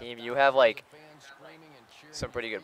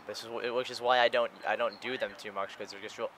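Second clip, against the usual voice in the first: normal talking voice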